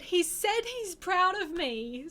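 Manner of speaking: emotional, overjoyed. Retold or imitated. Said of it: "And I said".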